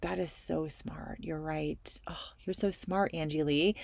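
hushed, breathless admiration; awed whisper. Retold or imitated. Telling her friend how smart her advice was, in an awed whisper. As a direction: whisper it like you're starstruck by her wisdom.

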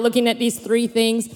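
usual speaking voice

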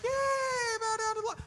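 squeaky high mock cheer from the crowd of animals; gleeful high-pitched cartoon critter yelling. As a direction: ham up a Disney-style animal chorus. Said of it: "all the animals are like".

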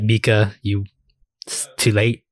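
usual speaking voice

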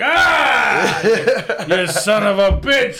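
high startled yelp, laughing; mock startled shout. Shaking a fingerless hand, startled and laughing. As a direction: jump at a missing finger. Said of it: "you're like".

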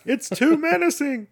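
high, loud fussy parent voice; a scandalised mother complaining about a kids' movie, high and loud; mock outraged parent. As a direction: play a clutching-pearls parent. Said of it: "all the parents in the".

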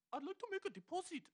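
put-on Indian accent. Indian man at the bank, hammed-up high Indian accent. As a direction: play an Indian bank customer, squeaky high. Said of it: "indischer Dude an der Bank".

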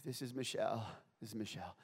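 soft, groggy phone answer; sleepy. Acted. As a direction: answer as if just woken up. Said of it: "She was already asleep".

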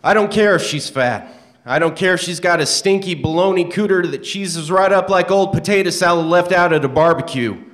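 loud, high, preaching rant. Acted. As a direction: lecture him like a fired-up preacher. Reading a character's preachy rant.

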